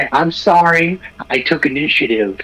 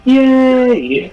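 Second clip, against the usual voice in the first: cheer; 10 semitones higher, 6 dB louder than usual. Real, not acted. Cheering the bard.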